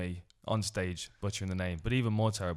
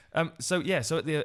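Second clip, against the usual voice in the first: normal talking voice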